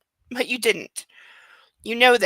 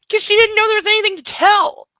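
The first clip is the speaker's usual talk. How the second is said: put-on high, louder young woman voice; girlish Anna voice; 10 semitones higher, 6 dB louder than usual. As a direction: voice the girlfriend brightly. Voices Anna's reply.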